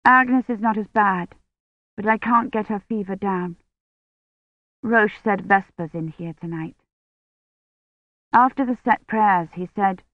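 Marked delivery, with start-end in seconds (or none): none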